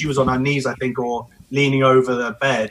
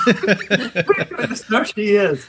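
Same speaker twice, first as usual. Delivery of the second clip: higher, slow, laughing hammed-up Irish accent; Irish accent impression; 7 semitones higher than usual. Husband retrying the midwife's line, hammed-up Irish accent. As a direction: go for a bigger, sillier Irish accent while laughing. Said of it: "I can't do an Irish accent".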